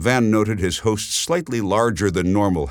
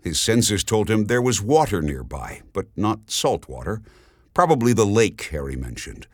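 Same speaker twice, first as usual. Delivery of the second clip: ordinary delivery, nothing marked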